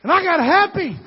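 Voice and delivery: high, loud burst of joy; joyful shout. Acted. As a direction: shout it out, overjoyed. Preacher climax after long pause.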